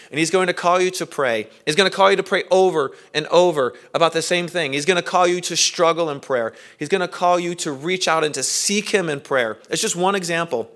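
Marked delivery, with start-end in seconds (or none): none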